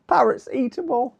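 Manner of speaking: giggling through the punchline word; laughing. Real, not acted. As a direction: laugh as you repeat the pun. Laughing at own pun.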